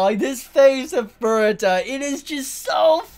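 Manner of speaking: normal talking voice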